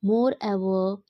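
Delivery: usual speaking voice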